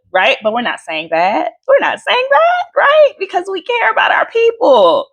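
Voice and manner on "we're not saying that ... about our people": mock sweetness.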